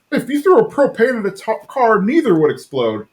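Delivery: high-pitched mock indignation; Hank. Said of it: "and Hank's like".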